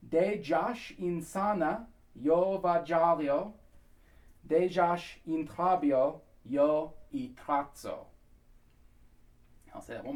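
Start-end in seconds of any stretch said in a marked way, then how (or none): 0.1-8.1 slow, evenly spaced foreign words; pronunciation demo. Shown as a demonstration. Coach teaching the next bit, slow and careful. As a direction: say "De Josh insana" deliberately, syllable by syllable. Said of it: "Then the next bit here".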